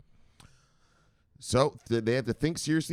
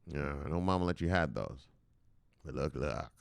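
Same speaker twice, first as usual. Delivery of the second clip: low, softer fatherly "look, look"; mock stern daddy; 6 semitones lower, 6 dB quieter than usual. A dad calmly lecturing the kid, low and soft. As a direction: drop the voice low like a patient dad. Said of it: "FinCEN's like daddy".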